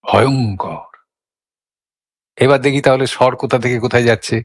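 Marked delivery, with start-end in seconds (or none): 0.0-0.9 low, drawn-out word full of feeling; expressive dread. Shown as a demonstration. Teacher shows how the word carries its feeling, expressive dread. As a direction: let ভয়ঙ্কর carry the dread, low. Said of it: "ভয়ঙ্কর অনুভব কিন্তু ঠিক রেখে".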